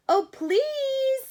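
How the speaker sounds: high loud begging voice; pleading rabbits. Acted. Rabbits begging the butterfly to play, high loud plea. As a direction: squeal out the plea. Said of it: "said the rabbits".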